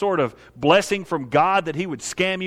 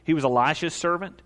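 ordinary delivery

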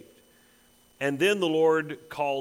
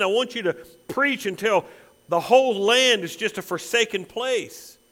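loud, high, relentless preaching crescendo; thundering pulpit commission; impassioned preaching; 6 semitones higher, 5 dB louder than usual. Acted. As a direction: drive each repeated charge harder and higher. Preaches a mounting charge as God.

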